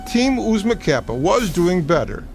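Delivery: usual speaking voice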